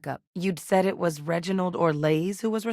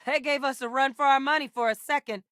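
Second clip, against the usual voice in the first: tag-worthy combat callout; 8 semitones higher than usual. Acted. Combat remark called out after a fight.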